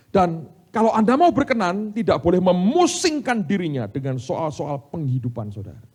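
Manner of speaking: high, loud, emphatic preaching; full-force preaching. Acted. Preacher charging the congregation with scripture, full-force preaching. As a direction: proclaim it at the top of your voice.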